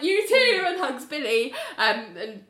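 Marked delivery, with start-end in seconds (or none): 0.0-0.6 awkward. Retold or imitated. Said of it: "she's like".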